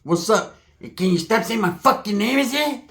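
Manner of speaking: high, odd mock voice; Pepe. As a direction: imitate him with the pitch leaping up at the ends. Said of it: "that's like if Pepe said".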